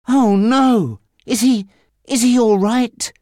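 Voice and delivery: put-on high, worried gasp of a young man. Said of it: "very worried".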